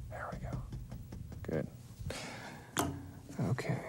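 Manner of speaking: breathy murmur to himself; whispered. Real, not acted. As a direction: hush it under the breath. Near-whispered self-talk.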